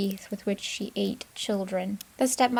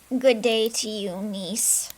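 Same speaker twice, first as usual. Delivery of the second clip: a lightly voiced old witch, louder; 5 dB louder, slower than usual. Witch greets the frightened girl in a hag voice. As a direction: greet her like a sly hag. Baba Yaga greets niece.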